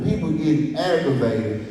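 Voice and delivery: ordinary talk, nothing marked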